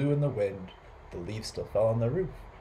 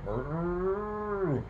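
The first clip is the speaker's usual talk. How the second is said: long, high rounded moo; cow mooing; 6 semitones higher than usual. A narrator's reading. Storyteller voicing the cow in the yard, long moo. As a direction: imitate a cow with one sustained moo. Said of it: "and the cow going".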